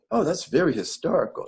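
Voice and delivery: high, lightly imitated British lady; British accent. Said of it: "she has a British accent".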